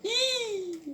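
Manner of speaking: sudden high-pitched squeak of fright; startled squeak. Real, not acted. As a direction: yelp in surprise, very high. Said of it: "il m'a fait peur".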